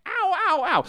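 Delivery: high yelping ows; mock yelps of pain. Shown as a demonstration. Demonstrating the cane hit sound, high mock yelps. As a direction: yelp like you've been bonked on the head. Demo of the head-hit yelp.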